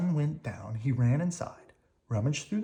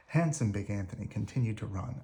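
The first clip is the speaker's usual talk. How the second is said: ordinary delivery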